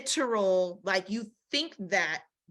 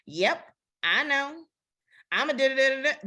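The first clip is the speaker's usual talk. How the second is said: mocking sass; 4 semitones higher than usual. Mocking modern careless woman.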